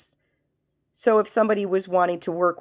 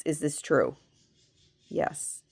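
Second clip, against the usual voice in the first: usual speaking voice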